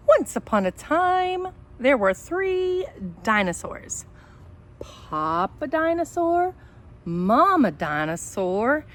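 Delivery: slow, animated read-aloud voice; storybook reading. Acted. Teacher reading a picture book to her students, animated storytelling. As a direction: read it like story time for little kids.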